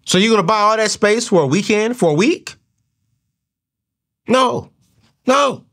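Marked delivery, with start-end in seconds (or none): 4.3-5.6 emphatic no. Real, not acted. Firm refusal to viewers.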